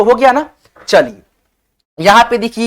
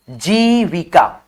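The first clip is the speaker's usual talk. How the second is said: syllable by syllable; 4 semitones lower, much slower than usual. Shown as a demonstration. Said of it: "यहाँ पे देखिए छोटी ई की मात्रा".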